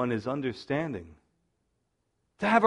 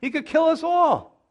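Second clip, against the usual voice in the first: exasperated Jacob; 6 semitones higher than usual. Retold or imitated. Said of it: "It's like".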